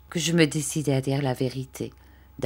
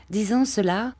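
ordinary delivery, nothing marked

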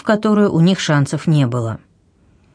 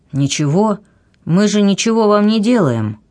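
plain reading voice

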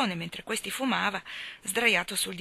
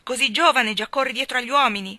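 angry scolding; 4 semitones higher, 8 dB louder than usual. A narrator's reading. Old servant scolding girl.

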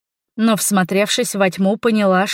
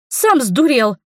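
higher-pitched indignant retort; snapping back; 5 semitones higher, 3 dB louder, slower than usual. A narrator's reading. Heroine to Linos mid-fight, snapping back. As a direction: snap straight back at him. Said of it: "огрызнулась я".

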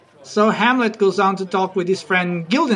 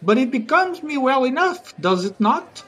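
lightly acted, higher-pitched knight; mock self-defence, pitched up; Sir Andrew; 8 semitones higher than usual. Knight defends his hair to his friend, lightly acted high voice. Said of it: "And Sir Andrew says".

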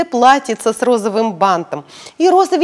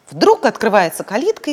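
plain reading voice

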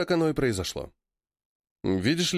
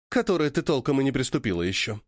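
ordinary delivery, nothing marked

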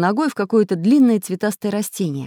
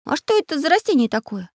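put-on child voice; 10 semitones higher than usual. A narrator's reading. Boy Chizhik asks.

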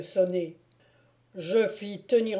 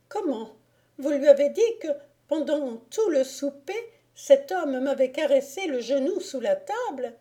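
high, incredulous questioning; aghast lady; 6 semitones higher than usual. A narrator's reading. Lady learning the lord told her husband, aghast. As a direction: ask in a high, scandalised voice.

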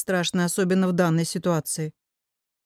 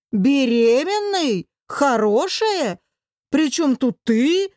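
higher, drawn-out outraged questions; indignant echo; 4 semitones higher, much slower than usual. A narrator's reading. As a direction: throw each word back slowly in disbelief. Furious man echoes friend's words in disbelief. (возмущённо переспрашивает, растягивая слова)

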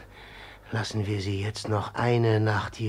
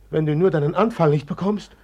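higher, louder fearful plea; anxious worry; 5 semitones higher, 6 dB louder, faster than usual. Acted. Pawin frets his trembling brother will have a fit. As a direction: fret aloud for him.

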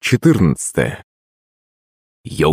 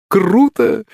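high-pitched gleeful exclamation; enthusiastic; 12 semitones higher than usual. A narrator's reading. Akulych relishes meeting the servant, high and enthusiastic. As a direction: burst out with eager delight. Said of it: "почесал ладоши от энтузиазма".

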